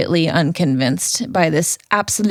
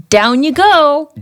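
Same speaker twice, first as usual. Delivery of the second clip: cool hero catchphrase; 8 semitones higher, 6 dB louder than usual. Retold or imitated. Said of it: "And he's like".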